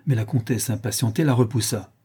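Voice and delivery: ordinary delivery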